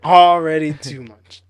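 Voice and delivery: drawn-out, stressed already too much; slow mock echo. Echoing his friend, slow deliberate repeat.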